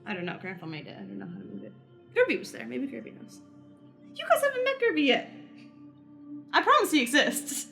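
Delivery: normal talking voice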